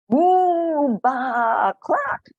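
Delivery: loud high moo, baa and cluck; animal noises scaring. Acted. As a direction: spook the farmer with farmyard cries. Animals scare the farmer.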